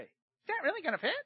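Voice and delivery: a light skeptical whine; skeptical customer. Retold or imitated. Customer doubting the glass will fit, lightly mimicked. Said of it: "They always say that".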